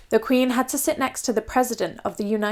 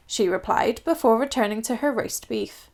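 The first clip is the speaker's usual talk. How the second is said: plain reading voice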